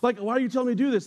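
high, fast, baffled questioning; confused challenge. Retold or imitated. Young man on base arguing with father-in-law, baffled and high. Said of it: "I'm like".

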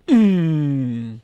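loud, abrupt closed-mouth grunt "mm"; imitated tic grunt. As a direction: bark out a sudden nasal-free tic hum. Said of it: "I think that was, like, his tick".

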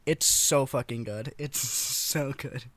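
ordinary delivery

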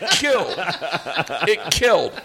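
usual speaking voice

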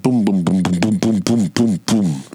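rhythmic vocal booms of a fall; imitated tumbling thuds. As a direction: thump out each stair with the voice. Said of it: "That's the sound of a child falling down".

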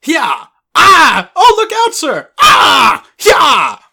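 high, loud fighting yells; battle cries. Acted. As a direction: yell like soldiers in a brawl.

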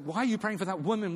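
high, put-upon voice of a flustered disciple; exasperated disciple. Retold or imitated. Disciples fretting at Jesus over delays, high exasperated whine. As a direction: whine it like an impatient follower. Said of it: "It's like".

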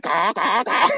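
high, quick run of caws; crows cawing. Shown as a demonstration. Crows spot two hawks up high, high urgent caws. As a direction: squawk like alarmed crows. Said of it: "they said".

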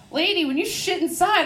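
high-pitched comeback; acted indignant retort. Dog owner to lady at the window, acted indignant retort. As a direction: fire back in mock indignation.

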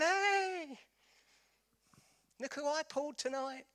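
high, cocky boast, lightly voiced; mock a show-off bragging online; mock bragging guy. A bragging lad online, mocking cocky boast.

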